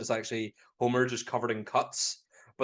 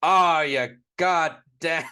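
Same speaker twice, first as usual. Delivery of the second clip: slow, loud New Jersey wise-guy growl; 4 semitones higher, 9 dB louder, much slower than usual. Retold or imitated. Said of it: "a New Jersey kinda wise guy sort of voice".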